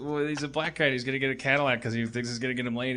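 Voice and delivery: ordinary talk, nothing marked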